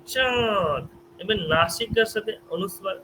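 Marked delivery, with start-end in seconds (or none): 0.0-0.8 long, nose-resonant aa in চাঁদ; nasalised vowel. Shown as a demonstration. Teacher demonstrating chandrabindu to students, nasalised vowel. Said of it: "চাঁদকে যখন উচ্চারণ করব".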